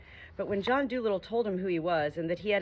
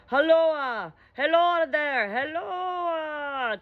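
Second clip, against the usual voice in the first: high, loud hailing cries; calling out; 9 semitones higher, 5 dB louder, much slower than usual. Acted. Animals calling to the bare rock, loud and high. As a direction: hail the empty rock. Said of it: "they all started calling".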